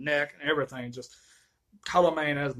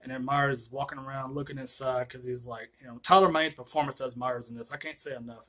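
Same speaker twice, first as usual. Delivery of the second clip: ordinary talk, nothing marked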